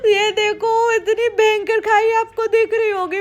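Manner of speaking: 声音发颤，带着哭腔的害怕; frightened, near tears. Real, not acted. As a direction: voice rising high and tearful with fear. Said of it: "इतना डर लग रहा है".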